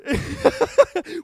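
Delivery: high burst of joyful laughter; giddy laugh. Real, not acted. After retelling the backdoor cover, a high delighted laugh. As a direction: laugh out in disbelief.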